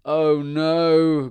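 a loud groaning oh no; groaned. A narrator's reading. Stan groaning at a hundred children. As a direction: groan it out in dismay. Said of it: "groaned Stan".

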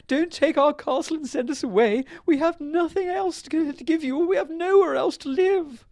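very high, loud, hammed-up begging; crying plea. A narrator's reading. Queen begs to keep the castle, high wailing plea. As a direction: wail it high as a desperate queen. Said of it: "cried the queen".

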